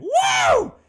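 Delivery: wild whooping high and loud; adrenaline-crazed shouting after a street fight; manic screaming. Retold or imitated. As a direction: whoop like a coked-up brawler. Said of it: "is screaming".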